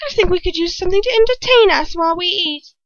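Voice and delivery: high, sly villainess; Bellatrix voice. A narrator's reading. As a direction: purr the demand from up high like a spoilt witch.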